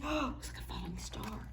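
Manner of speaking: hushed wonder, quick; quick breathy aside; excited whisper. Real, not acted. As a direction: whisper it as if seeing a falling star. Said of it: "whispering wonder at the page".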